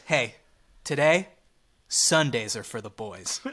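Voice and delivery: low, slightly confiding guy-next-to-you line; lightly voiced Bryce. Fan turns to his couch neighbor, lightly imitated low aside. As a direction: drop the pitch for the pushy fan. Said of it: "he turns to you and says".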